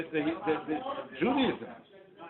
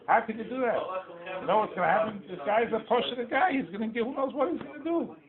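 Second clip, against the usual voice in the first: higher-pitched, incredulous protest, not shouted; alarmed objection; 6 semitones higher, 4 dB quieter than usual. Retold or imitated. Angels challenge the blessing given to a simple man, alarmed protest. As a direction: protest in a high, worried voice.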